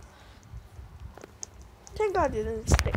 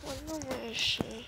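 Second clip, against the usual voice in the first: whispering; 10 dB quieter than usual. Real, not acted. Hiding from the beast.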